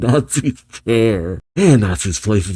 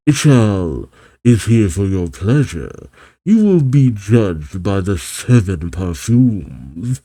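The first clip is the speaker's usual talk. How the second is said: slow theatrical pronouncement; hammed-up, drawn-out grand entrance; Ichiya impression. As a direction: draw it out like a pompous showman. Impersonating Ichiya making a theatrical entrance.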